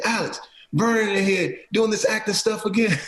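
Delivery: high, mocking call to a buddy; teasing teammate. Sprinkle teasing Vernon to Alex, high mock call. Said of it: "He said".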